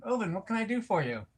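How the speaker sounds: ordinary talk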